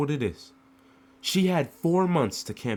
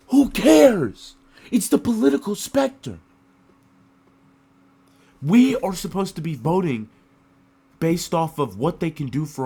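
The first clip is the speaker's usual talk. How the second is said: outraged shout; 5 semitones higher, 8 dB louder than usual. Real, not acted. Outraged rant about election attacks.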